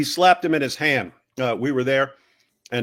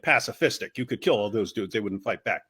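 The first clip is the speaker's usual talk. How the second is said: ordinary talk, nothing marked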